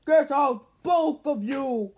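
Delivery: loud slow croaked order; croaking shout. A narrator's reading. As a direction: croak it out furiously. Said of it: "croaked Uncle Her".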